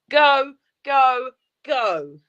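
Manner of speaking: urging shout. Real, not acted. Urging William to hurry.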